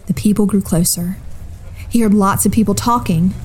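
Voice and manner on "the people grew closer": ordinary delivery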